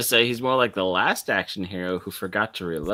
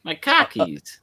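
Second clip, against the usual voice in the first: put-on Boston accent; 10 semitones higher, 3 dB louder, slower than usual. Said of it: "Car keys".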